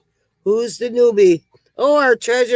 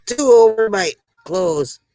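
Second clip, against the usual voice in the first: normal talking voice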